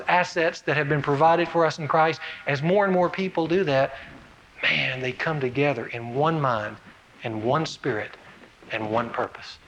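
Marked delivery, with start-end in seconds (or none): 4.6-9.6 much softer, lower, intimate; soft preaching. Real, not acted. Preacher to church about unity, softening. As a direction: draw them in quietly. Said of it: "preacher softens at climax".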